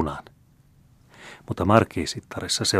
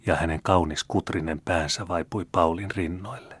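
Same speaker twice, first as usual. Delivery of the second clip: plain reading voice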